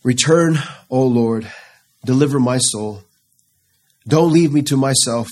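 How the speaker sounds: preacher voicing David begging God for mercy. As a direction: beg the Lord to return and save. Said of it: "David begging for a return".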